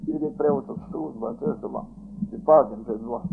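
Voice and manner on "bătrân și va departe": ordinary talk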